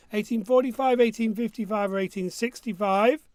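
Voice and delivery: usual speaking voice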